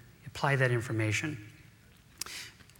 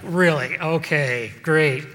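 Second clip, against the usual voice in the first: mock sarcasm; 7 dB louder, much slower than usual. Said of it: "I was like".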